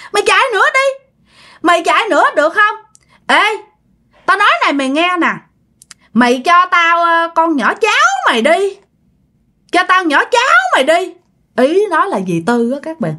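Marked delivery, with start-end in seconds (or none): 0.1-11.1 put-on high, menacing old-woman voice; high-pitched sinister crone; taunting ghost hag. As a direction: demand a victim as a gloating ghost. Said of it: "Bà nói rằng".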